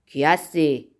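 restrained narrated shout, pitched low; a low, firm challenge called out; challenging shout. A narrator's reading. Zorba to a stranger leaping from the reeds, challenging shout. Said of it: "فریاد زد".

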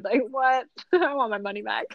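laughing through a joking retort. Real, not acted. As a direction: crack up while telling it. Joking retort, laughing at her story.

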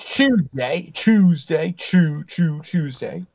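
lightly voiced mock British Tuesday. Cohost joins the mockery of Brits, mock British voice. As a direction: chant Tuesday in a silly put-on British voice.

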